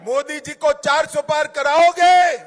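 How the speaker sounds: shouted rally questions; booming political rally speech. Acted. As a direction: rouse the crowd to answer.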